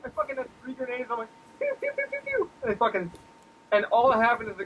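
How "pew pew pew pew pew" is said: very high, quick pew noises; playful imitated shots; mock gun sounds. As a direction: spit out toy-gun pews.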